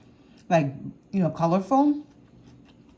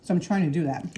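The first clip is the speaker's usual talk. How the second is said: ordinary talk, nothing marked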